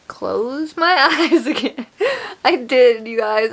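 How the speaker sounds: high, loud laughing confession. Real, not acted. Confessing to viewers she shut her eyes, laughing. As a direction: break into laughter through the words.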